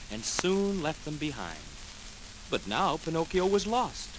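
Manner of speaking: plain reading voice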